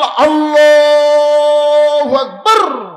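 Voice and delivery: huge, long-drawn shout; called-out takbir. Acted. Rakhal cutting in as the iqamah is called, loud drawn-out call. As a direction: stretch the takbir out at full volume.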